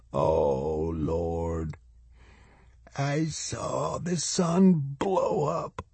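put-on soft, failing old man's murmur; muttering. A narrator's reading. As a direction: breathe it out feebly, rising into a thin high voice. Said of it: "He started muttering again".

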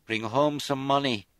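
loud, high, slow call after them. A narrator's reading. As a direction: call it out across the room. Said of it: "Bridget called as they left".

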